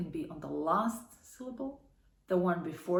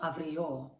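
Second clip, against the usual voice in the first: avrio with the final O stressed; wrong stress, last syllable; 3 semitones lower than usual. Shown as a demonstration. Teacher showing another wrong take, avrio stressed on the final O. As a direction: say avrio as av-ree-O. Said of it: "not avrio".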